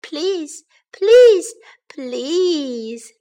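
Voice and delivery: high pleading, loud burst in the middle; begging. A narrator's reading. Baby bear pestering parents for a chair, high begging. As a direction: beg insistently, stretching the last. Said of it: "begged Baby Bear".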